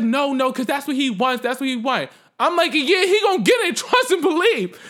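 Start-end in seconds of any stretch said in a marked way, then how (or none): 0.0-2.1 higher, pressing imitation of a bystander; urgent dissuading. Lady urging her not to fight the man, imitated urgency. 2.7-4.8 loud, high re-enacted threat; fired up for a fight; really hyped. Retelling her answer to the lady, loud and hyped. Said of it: "I got really hyped".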